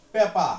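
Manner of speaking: পেপা with no r at the end; পেপা as the English say it, r silent; non-rhotic paper. Shown as a demonstration. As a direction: say পেপা, dropping the final r. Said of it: "ওরা এটা উচ্চারণ করবে".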